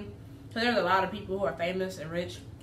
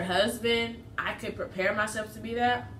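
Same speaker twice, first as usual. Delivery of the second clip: talking while taking bite; biting into food; 2 semitones higher, slower than usual. Real, not acted. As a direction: mouth partly full of food. Seen 3: Food held to mouth and bitten from 3.2s while she talks.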